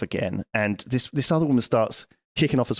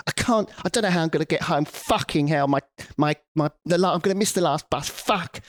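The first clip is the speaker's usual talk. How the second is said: mock angry tirade; 4 semitones higher than usual. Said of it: "she would have this tirade".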